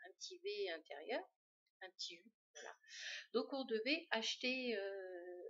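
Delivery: ordinary delivery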